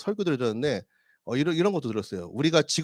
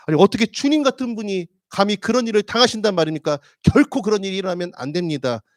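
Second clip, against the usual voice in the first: passionate protest; 5 semitones higher, 6 dB louder than usual. Acted. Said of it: "뜨거운 열정으로".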